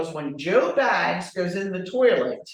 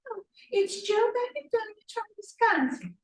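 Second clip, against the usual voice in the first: high, breezy mock-chirpy answer; lilting high mock reply; chirpy agent. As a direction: play a clueless agent, cheerful. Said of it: "Let me ask the question".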